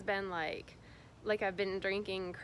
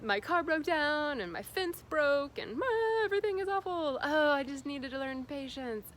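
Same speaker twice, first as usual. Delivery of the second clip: mock dramatic wail; 7 semitones higher, 5 dB louder than usual. Mocking her past self's meltdown, high and overwrought. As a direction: ham up the old emotional meltdown. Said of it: "It would be like".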